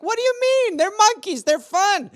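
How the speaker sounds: very high mock innocent voice; cartoon-minded self. Picturing cheerful animated monkeys, very high mock innocence. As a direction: chirp it like a naive cartoon fan. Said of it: "I'm like".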